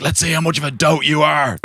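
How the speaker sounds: pitched high, teasing and scheming; mock scheming. Herself teasing the campsite man about a late knock, mock scheming. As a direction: play the prankster about to test the dote. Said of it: "I'm like".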